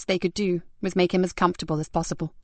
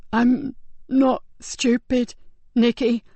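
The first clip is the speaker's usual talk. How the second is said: higher, slow, strained dying voice; weak dying man; 5 semitones higher, 4 dB louder, much slower than usual. Acted. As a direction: barely hold on to each word.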